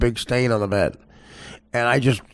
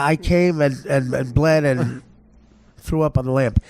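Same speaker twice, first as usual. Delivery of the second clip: ordinary delivery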